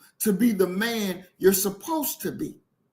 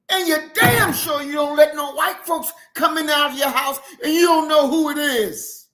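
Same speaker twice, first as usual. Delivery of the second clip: loud, higher-pitched, emphatic scolding; indignant outburst; 5 semitones higher, 3 dB louder than usual. Real, not acted. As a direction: lay down the law in outrage. Indignant rant at the listener's naivety.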